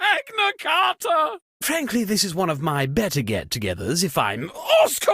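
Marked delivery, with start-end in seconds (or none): 0.0-1.4 high shout breaking into a laugh; startled laughing cry. Acted. Host startled in the dark, high laughing shout. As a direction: yelp it through a laugh.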